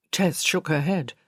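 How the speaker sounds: plain reading voice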